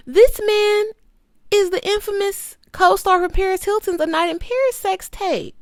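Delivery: ordinary delivery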